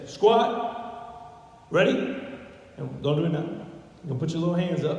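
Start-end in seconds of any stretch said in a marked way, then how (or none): 0.0-2.2 loud rising drill call; drill command. Shown as a demonstration. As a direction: call it out like a Marine drill instructor. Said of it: "And say".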